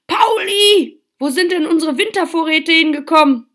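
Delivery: high, loud cry of alarm; shocked cry. A narrator's reading. Mother finding the pantry half empty, shocked. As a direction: demand answers, aghast and loud. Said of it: "fragte sie erschrocken".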